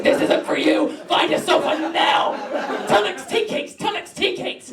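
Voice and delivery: high-pitched mock yelling at a runner; heckling snack gods. Gods of treacle pulling runners down, heckling in a high voice. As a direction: chant it like jeering little gods.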